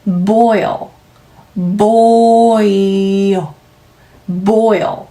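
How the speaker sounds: "boil" drawn out with a gliding oi; slow boil demo. Shown as a demonstration. Teacher repeating "boil" for the class. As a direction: stretch the oi in "boil". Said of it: "마무리하시는 소리네요".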